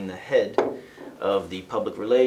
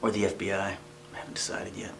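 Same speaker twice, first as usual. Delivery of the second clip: whisper; 8 dB quieter, faster than usual. Acted. Trailing confession to newcomer.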